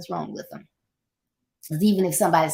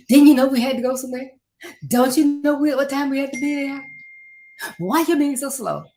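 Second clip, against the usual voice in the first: louder, fast, impatient scolding; impatient nagging; 6 semitones higher, 5 dB louder, faster than usual. Retold or imitated. Wife nagging her husband to hurry, fast and loud. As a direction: hurry him up, fast and sharp.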